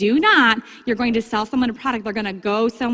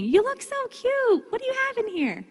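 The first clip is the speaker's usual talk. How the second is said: high sing-song customer voice; cooing customer; 13 semitones higher, 4 dB quieter than usual. Retold or imitated. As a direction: coo it like a charmed shopper. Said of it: "people will say to me".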